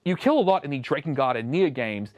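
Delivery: ordinary talk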